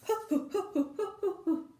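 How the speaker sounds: high playful giggle; a child's gleeful laugh; giggling laugh. Acted. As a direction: bubbly giggle. Children running upstairs.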